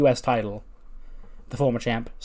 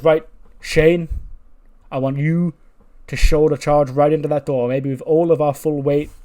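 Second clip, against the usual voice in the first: loud, higher rallying instruction; commanding; 4 semitones higher, 9 dB louder than usual. Acted. Teacher directing Shane to shoulder charge the door, commanding. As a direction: marshal them with a forceful order.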